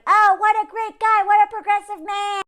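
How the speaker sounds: high, loud gushing; overdone fan adoration, high and loud; mock gushing fans. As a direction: gush over him in mock praise. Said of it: "look at these quotes".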